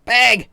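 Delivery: "bag" loud, with a Midwestern a; emphatic "bag". Shown as a demonstration. Friend drills the word, loud "bag". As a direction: punch out "bag" by itself. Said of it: "It's bag".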